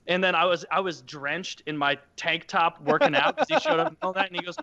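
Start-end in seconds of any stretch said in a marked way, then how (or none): none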